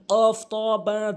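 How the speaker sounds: কফ, ত, বা drawn out and loud; slow emphatic listing. Shown as a demonstration. Teacher stresses the qalqala letters slowly. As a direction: spell out কফ, ত, বা slowly and firmly. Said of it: "কলকলা সবচেয়ে বেশি মানুষের ভুল হয়".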